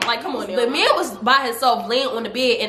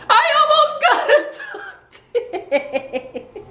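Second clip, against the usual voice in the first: high squeaky wail, half laughing; mock crying; slower than usual. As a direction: whimper it as exaggerated crying, voice giving out. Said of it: "I'm just crying like".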